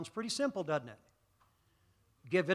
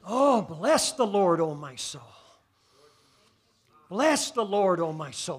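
worship call; 7 dB louder, slower than usual. Real, not acted. Worshipful sung-out blessing.

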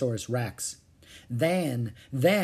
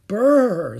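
shivering; 8 semitones higher, 11 dB louder than usual. Acted. Rain blowing in, cold.

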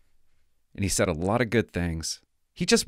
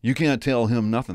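lightly voiced know-it-all veteran; a hint of a scoffing old cop; dismissive old-timer. Mocking the jaded old cop to a young recruit, lightly voiced. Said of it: "I'm not one of those guys that says".

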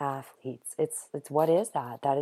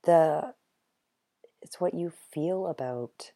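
straining, voice almost gone; voice giving out; 5 dB louder than usual. Real, not acted. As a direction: voice cracking into a whisper. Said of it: "losing my voice here as we go".

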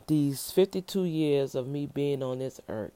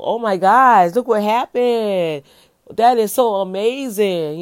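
higher and much louder, bursting with wonder; amazed exclamation; 6 semitones higher, 8 dB louder than usual. Shown as a demonstration. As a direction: gush like you can't believe your eyes. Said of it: "that's like something like".

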